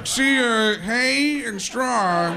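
loud, high, stretched-out explanation; explaining announcer. Acted. Announcer cutting in to explain the pun, loud and drawn out. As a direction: spell it out in a booming drawl.

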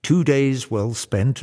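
ordinary delivery

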